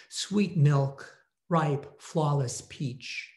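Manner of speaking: usual speaking voice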